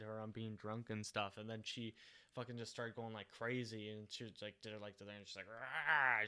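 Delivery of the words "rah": loud imitated rage noise; short, loud retold outburst; mock angry roar. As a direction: roar briefly as someone going crazy. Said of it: "She get, like, really mad".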